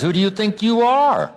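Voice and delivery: pitched well up, scornful and biting; mock the listener's pride out loud; sarcastic challenge. Preacher quoting sarcasm to an audience, scornful high challenge. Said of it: "Sarcasm says".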